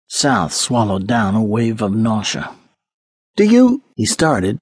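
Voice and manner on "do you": high, loud urgent start. A narrator's reading. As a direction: blurt it out anxiously. Anxious start of question.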